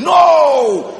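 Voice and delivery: high, loud refusal. Real, not acted. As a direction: bark the no at them. Preacher rejects the complaint.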